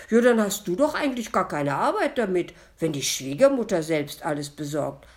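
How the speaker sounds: loud, quick disappointed objection. A narrator's reading. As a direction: object loudly, openly let down. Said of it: "machte ein sehr enttäuschtes Gesicht". (laut enttäuscht)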